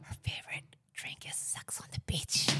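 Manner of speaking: close-mic whisper. Shown as a demonstration. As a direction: whisper it into the microphone. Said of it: "I have to whisper".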